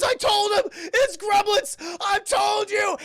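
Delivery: high, loud, drawn-out panic; mock terrified screaming. Husband as the tractor crashes into the living room, mock screaming. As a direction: scream it as a hammed-up doomed man. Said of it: "they just start screaming".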